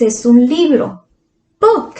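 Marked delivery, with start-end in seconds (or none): 1.6-1.9 "Book" popped up high and falling fast; high quick "Book"; high bright book. Shown as a demonstration. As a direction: fling "Book" up high for the kids. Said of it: "Y book".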